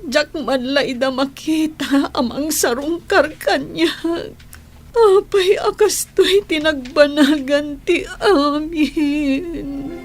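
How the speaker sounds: building from tearful to openly crying; sobbing lament. Acted. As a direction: break down crying, stretching the last words. Sick woman breaks down crying. (agsasaibbek nga agsangit)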